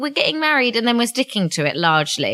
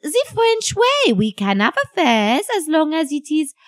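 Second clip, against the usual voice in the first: higher, breezy French wife voice; French accent; 7 semitones higher than usual. Acted. Wife excusing the affair to her husband, French accent.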